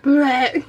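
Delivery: a second mock gag, cut with laughter; laughing retch. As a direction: gag again, still giggling. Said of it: "So I am laughing".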